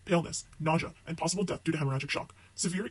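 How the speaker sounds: ordinary talk, nothing marked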